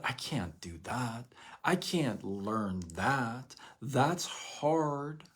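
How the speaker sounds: slow, defeated excuse-making; mildly whiny excuse. Retold or imitated. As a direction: drag it out like giving up. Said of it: "there was a time you could tell me".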